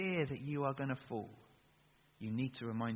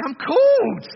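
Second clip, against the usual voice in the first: much higher and much louder, a joyful shout; enthusiastic shout; 21 dB louder, slower than usual. Shown as a demonstration. Preacher showing the crowd how to answer, joyful shout. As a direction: belt it out with full enthusiasm. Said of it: "say it with a bit more enthusiasm".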